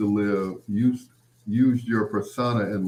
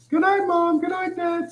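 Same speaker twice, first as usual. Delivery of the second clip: put-on squeaky little boy voice, much higher; childhood voice. Storyteller imitating his boyhood voice to his parents, very high and squeaky. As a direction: pipe it up like a small kid. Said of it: "my voice changed".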